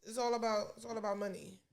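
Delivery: normal talking voice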